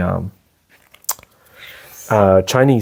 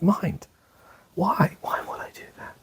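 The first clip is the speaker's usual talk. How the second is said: softer, trailing into a whisper; hushed plea; 7 semitones higher, 10 dB quieter than usual. Pretend. Meditator quietly questioning his own mind, fading to a whisper. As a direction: ask your mind why, almost under your breath.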